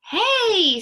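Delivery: loud, high, drawn-out hey; surprised greeting. A narrator's reading. Customer turns around at the store, surprised. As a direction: call out on recognizing someone.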